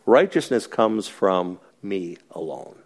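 firm Jesus. Retold or imitated. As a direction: lay it down slowly and firmly. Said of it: "nail you between the eyes and say".

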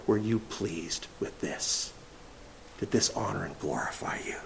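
whispered, soft prayer to God; breathy hushed prayer. Acted. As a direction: drop to a whisper as if praying. Prayer voiced inside sermon.

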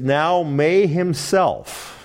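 "Now may himself" drawn out very slowly; emphatic Greek order. Shown as a demonstration. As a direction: linger on each word of "Now may himself". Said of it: "It's a little awkward".

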